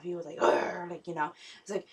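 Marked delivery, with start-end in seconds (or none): 0.4-0.9 low growling grunt from an angry dad. Retold or imitated. Dad growls at his daughter over steak, low angry grunt. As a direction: growl it out like a fuming father. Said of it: "he was all yelly and stuff".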